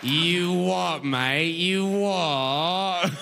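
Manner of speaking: drawn-out, hammed-up Cockney sounds; mock British accent. Fan apes a Briton just for the sounds, mock British. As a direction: stretch the vowels in a silly British accent. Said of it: "they're not saying words".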